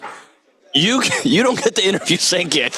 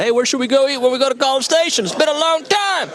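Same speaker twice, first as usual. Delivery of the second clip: much higher, voicing the radio hosts; high quoting voice; 10 semitones higher than usual. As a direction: lift into the hosts' voice.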